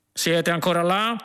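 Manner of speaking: shout. A narrator's reading. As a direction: call out to check they're there. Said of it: "gridò uno dei poliziotti".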